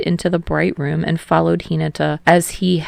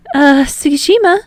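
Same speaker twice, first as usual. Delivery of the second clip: hesitant, higher and louder opener; a tentative call of his name; unsure; 9 semitones higher, 6 dB louder than usual. A narrator's reading. Hinata unsure about changing in front of Tsukishima, hesitant. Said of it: "sounding unsure".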